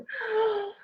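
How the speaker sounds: high gasp of delight; a quick delighted intake of breath. Acted. Princess reacts.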